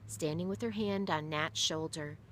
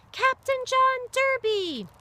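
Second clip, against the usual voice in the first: high, slow, loud chant; crowd yelling; 10 dB louder, slower than usual. Acted. Crowd yelling the captain's name, high and slow. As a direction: yell the name out proudly. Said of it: "The crowd yelled".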